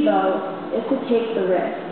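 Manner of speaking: ordinary talk, nothing marked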